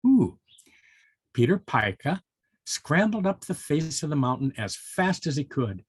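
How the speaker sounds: plain reading voice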